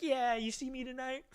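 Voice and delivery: high, drawn-out mock swagger; cocky cool. Boy showing off to his friends, cocky cool. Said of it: "thinking I'm all cool to my friends".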